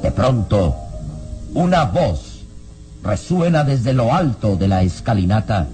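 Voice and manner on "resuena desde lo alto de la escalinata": ordinary talk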